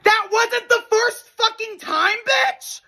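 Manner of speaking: high, slow, outraged exclamation; outraged disbelief. Real, not acted. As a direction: stretch it out in shock. Outraged reaction to kicker.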